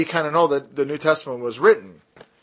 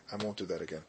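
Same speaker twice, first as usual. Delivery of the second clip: usual speaking voice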